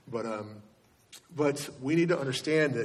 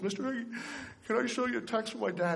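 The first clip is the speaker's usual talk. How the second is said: put-on higher, softer voice of a boy asking timidly; pleading boy; 9 semitones higher, 5 dB quieter, faster than usual. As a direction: plead like an upset kid at school. Crying boy's plea retold.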